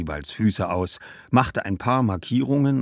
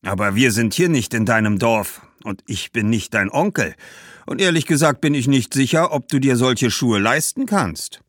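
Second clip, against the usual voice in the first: loud, raised, doubting merchant; stern shoemaker; 6 semitones higher, 5 dB louder than usual. Acted. Shoemaker doubting the girl can afford the shoes, sternly. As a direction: put the child in her place.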